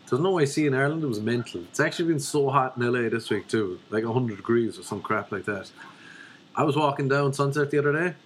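ordinary talk, nothing marked

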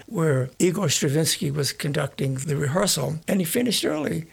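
ordinary talk, nothing marked

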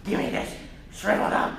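a fast, half-whispered refusal; stubborn refusal. Pretend. Preacher mocking the congregation clinging to crap, fast whispered refusal. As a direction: refuse petulantly, mostly breath. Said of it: "we're like".

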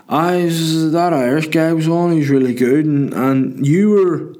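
slow, high, drawn-out fan impression; drunk young fella. Drunk fella praising the comedian, high slurry put-on voice. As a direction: mimic a drunk punter groping for words. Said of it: "just some drunk guy".

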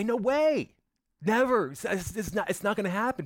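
earnest high-pitched denial; high insistent denial. Acted. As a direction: protest high and emphatic, no way. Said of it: "you would say".

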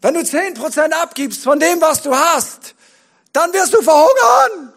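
high, loud panicky warning; alarmist inner voice. Acted. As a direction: shout it like a scaremongering voice. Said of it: "all diesen Stimmen in mir".

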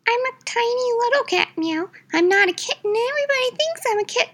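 very high, fast kitty voice; squeaky indignant kitten; tiny cat voice. Pretend. Fluffy insists on size.

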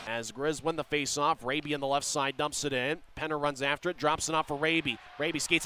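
quick steady play-by-play; rapid hockey play-by-play. Real, not acted. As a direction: call the action as it happens. Live play-by-play.

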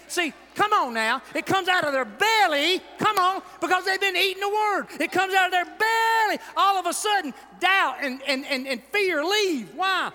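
loud, high-pitched shouting preacher; rising into a full shout; preaching at full force. Acted. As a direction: lift the crowd, preaching hard.

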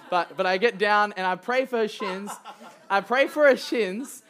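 amused, words bubbling with laughter; laughing. Real, not acted. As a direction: laugh while telling it. Laughing at own story.

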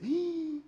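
high-pitched drawn-out squeal; long, high exclamation, put on; mock startled squeal. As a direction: imitate someone squealing on reading it. Imitates a reader's startled reaction.

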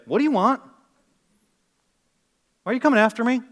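loud, high confronting questions; direct, blunt demand. Acted. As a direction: demand an answer head-on. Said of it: "He cuts right through the chase".

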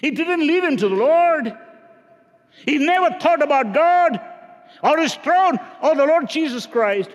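high, loud preacher's cry; full-force sermon delivery; fiery preaching. Real, not acted.

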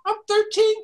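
put-on high, small child voice; little girl voice. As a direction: sound like a young girl. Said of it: "little voice".

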